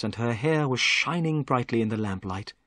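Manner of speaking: plain reading voice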